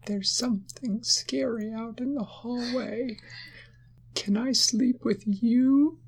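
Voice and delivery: a tiny bug asking politely. Acted. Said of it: "it said in a tiny voice".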